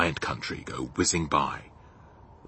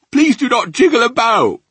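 loud, high-pitched begging; ticklish plea; 13 dB louder than usual. Acted. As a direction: plead loudly as a tickled giant. Giant pleads as he's tickled.

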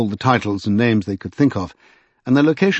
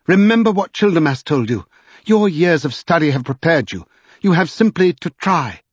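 high, impassioned encouragement; fervent urging; 6 semitones higher than usual. A narrator's reading. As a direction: spur him on with conviction. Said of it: "she cried with shining eyes".

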